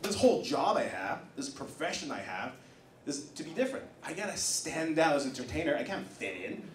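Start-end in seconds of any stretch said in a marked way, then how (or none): none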